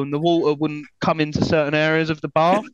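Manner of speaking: normal talking voice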